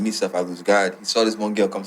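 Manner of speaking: ordinary talk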